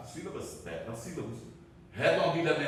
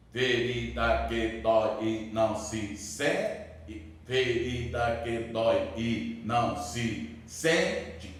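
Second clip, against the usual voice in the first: slow, lowered, evenly chopped scansion; syllable-by-syllable; 4 semitones lower, slower than usual. Shown as a demonstration. Teacher counting syllables in Camões's verse, syllable by syllable. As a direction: sound out each syllable separately, slowly. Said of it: "dez sílabas".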